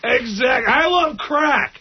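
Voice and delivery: high, slow, shouted cabbie blurt; Crazy Cabbie. Acted. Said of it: "his new Crazy Cabbie impression".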